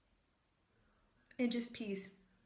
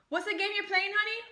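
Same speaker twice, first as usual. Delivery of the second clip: higher, louder call to her son; 9 semitones higher, 4 dB louder than usual. Real, not acted. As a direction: pitch it up to reach the next room. Asking son across the room.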